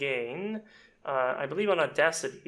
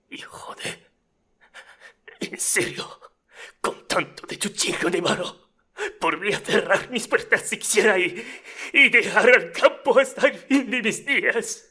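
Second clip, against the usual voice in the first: rough, snarky, rising to a loud high peak; emotional snarky audition; 7 semitones higher, 6 dB louder than usual. Acted. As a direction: start hushed, build to a high loud outburst. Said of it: "Let's say that's my audition here".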